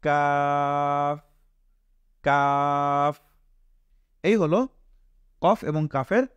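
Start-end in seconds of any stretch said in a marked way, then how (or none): 0.0-3.1 "কাফ" with an ordinary "k" and long "aa"; natural k sound. Shown as a demonstration. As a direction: stretch "কাফ" slowly, the k not deepened. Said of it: "কাফটা স্বাভাবিকভাবে বের হবে".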